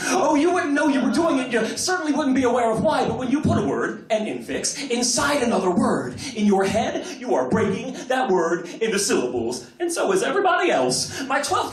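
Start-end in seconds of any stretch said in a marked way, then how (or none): none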